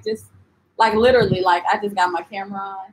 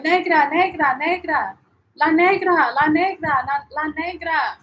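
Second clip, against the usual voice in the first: higher, sing-song hawker calls; mimicked vendor call; 6 semitones higher than usual. Cartagena vendors calling out to her, mimicked calling. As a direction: call out repeatedly like a street seller.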